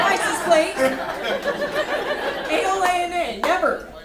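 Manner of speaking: high, loud, drawn-out spelling; indignant rant. Acted. Daughter with uncommon name complaining, loud indignant rant. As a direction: spell it out slowly at high volume, aggrieved.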